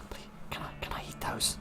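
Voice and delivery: hushed, whispered begging; whispered pleading. Acted. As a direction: beg in a tiny whisper. Player begs again under the breath.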